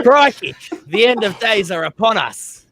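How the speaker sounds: high, hammed-up Aussie accent reading; Steve Irwin. Reading aloud for teasing friends, mock cool Aussie accent. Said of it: "Do Steve Irwin".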